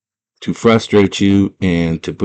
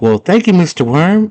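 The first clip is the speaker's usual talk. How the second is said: put-on high-pitched polite girl; girl's voice; 11 semitones higher than usual. Girl thanking the worm, high sweet voice. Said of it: "she's like".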